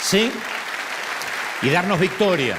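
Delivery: rising sermon push; preaching emphasis. Real, not acted. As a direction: drive it home to the congregation. Preacher pushing the point.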